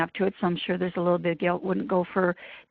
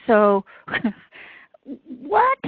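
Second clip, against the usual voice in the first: incredulous; 4 semitones higher, 3 dB louder than usual. Retold or imitated. Retold disbelief.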